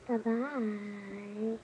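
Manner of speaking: ordinary talk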